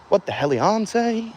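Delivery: playful disbelief. Real, not acted. Police heading to his flags.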